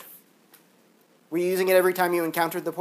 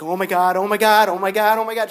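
retold freaking out; 4 dB louder than usual. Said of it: "I was, like, freaking out".

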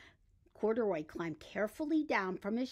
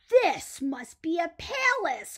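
slow, higher and louder in awe; amazed gasp; 7 semitones higher, 7 dB louder, slower than usual. Acted. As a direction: marvel at the palace. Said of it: "Corduroy gasped".

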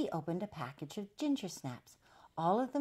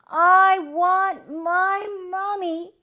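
very loud, high, drawn-out cry; baby's wail; 17 dB louder, much slower than usual. A narrator's reading. Baby crying out for his mummy in the rain, loud wail. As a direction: wail each word long and high. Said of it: "he cried".

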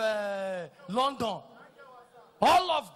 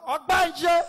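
mock hail; 5 semitones higher, 11 dB louder than usual. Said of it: "I say".

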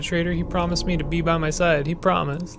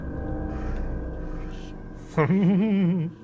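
a fading villain's cackle; evil laugh; 4 semitones higher, 4 dB quieter than usual. Acted. Monster in her head gloating over Fran, evil laugh. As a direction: gloat with a slow sinister laugh.

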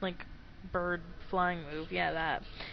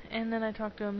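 ordinary delivery

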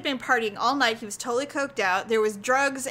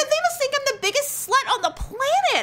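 very high, wailing mock despair; retold mortification; 13 semitones higher than usual. Woman retelling being taken for his mistress, mortified. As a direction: squeal it, comically aghast.

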